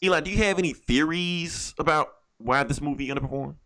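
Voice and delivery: ordinary talk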